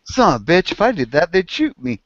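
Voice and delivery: dog's grumble, pitched well up; a lightly voiced farm dog complaining; high mock dog voice. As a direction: voice the envious dog, high and put-upon.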